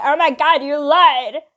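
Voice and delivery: loud, high mimic of an outraged friend; outraged accusation. Friend accusing her of lying, loud mock outrage. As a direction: burst out accusing, imitating her.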